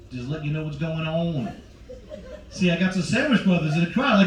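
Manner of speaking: ordinary talk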